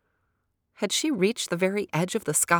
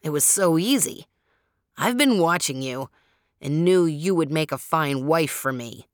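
smug porcupine; 4 dB louder than usual. A narrator's reading. Porcupine keeps gloating to Sapana.

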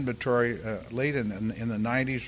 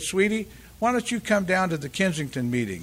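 put-on a lightly done, higher Southern accent; 7 semitones higher, 3 dB louder than usual. Susan inviting Dave to the Kensington meeting, light Southern accent. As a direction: mimic a gentle Southern invitation. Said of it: "she was a beautiful Southern accent".

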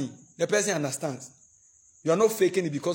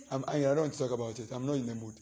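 mock sulk; 4 semitones lower, 6 dB quieter, faster than usual. Acted quarrel, sulking spouse.

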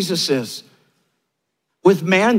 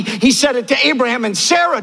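ordinary delivery

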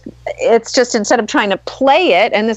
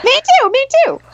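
excited squeal; faster than usual. Real, not acted. Sharing the joy of meeting.

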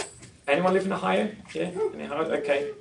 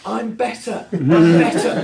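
exaggerated mouthing; 3 semitones higher, 8 dB louder, slower than usual. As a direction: act out mouthing news across a store. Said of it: "she's mouthing at me".

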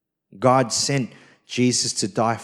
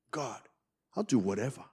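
quiet surrender; 10 dB quieter, faster than usual. Retold or imitated. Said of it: "a heart that loves God".

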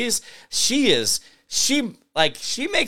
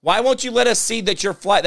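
normal talking voice

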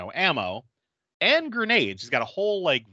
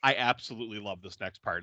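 usual speaking voice

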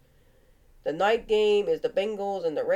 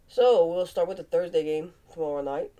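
ordinary delivery

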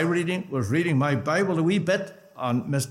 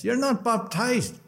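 higher, slow southern Irish brogue; southern Irish brogue, pitched up in disbelief; 9 semitones higher than usual. Retold or imitated. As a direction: imitate his southern Irish lilt, drawing out the question. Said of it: "he never lost his southern brogue".

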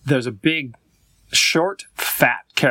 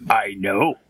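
slow, drawn-out cartoon character; lightly voiced slow dopey character; cartoon Clarence voice; 4 semitones lower, much slower than usual. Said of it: "And Clarence says".

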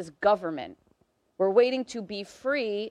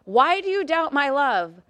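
raised, louder and slower, hurt disbelief; indignant God; 6 semitones higher, 7 dB louder than usual. Acted. God asking his people why they doubt his love, raised and slow. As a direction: lean on it like an offended parent. God answering Israel, quoted again.

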